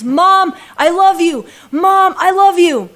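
high, loud, eager cry to Mom; excited calling out. Retold or imitated. Adopted daughter to mother at the airport, excited shout. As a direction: call out joyfully, loud and high. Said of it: "she's so excited".

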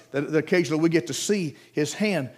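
normal talking voice

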